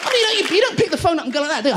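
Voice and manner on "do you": normal talking voice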